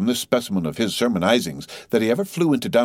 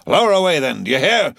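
high, loud bellowed order; shouting across the deck; 7 semitones higher, 5 dB louder than usual. A narrator's reading. Captain orders the boats lowered, shouting. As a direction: thunder the order at the men. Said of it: "shouting across the deck".

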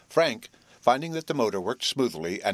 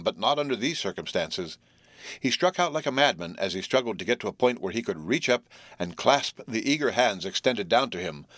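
plain reading voice

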